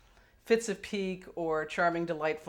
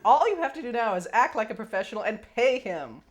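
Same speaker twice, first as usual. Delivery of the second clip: louder, higher mock quote of the letter; incredulous raised voice; indignant repeat; 8 semitones higher, 8 dB louder than usual. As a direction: throw his line back, outraged. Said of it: "she quotes the letter".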